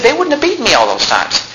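high, protesting apostle; exasperated apostle. Acted. Preacher voicing Paul protesting his beatings, exasperated.

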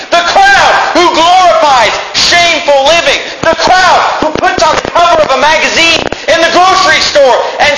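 high, shouted pulpit tirade; full-force preaching. Real, not acted. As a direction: thunder against the sinful crowd.

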